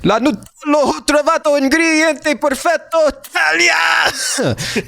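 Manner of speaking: high, drawn-out excited Italian cries; soaring, stretched Italian shouting. Retold or imitated. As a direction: channel an overjoyed Italian YouTuber. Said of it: "shout all of these things in Italian".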